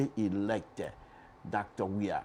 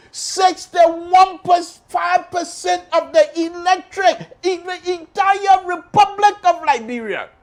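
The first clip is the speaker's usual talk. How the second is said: high, loud and slow proclamation; host proclaiming the vote share, shouting slowly; triumphant shout; 14 dB louder, slower than usual. Real, not acted. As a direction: boom out the result. Announcing election result.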